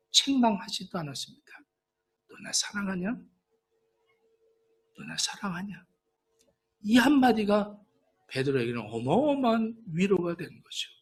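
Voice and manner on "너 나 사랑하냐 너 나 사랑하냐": soft question. Acted. Jesus asks Peter again.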